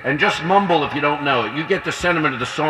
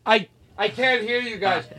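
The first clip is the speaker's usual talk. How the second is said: higher, slow mock complaint; imitating headphone wearer; 6 semitones higher, 4 dB quieter than usual. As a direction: mimic someone who can't hear himself under headphones. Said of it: "He goes".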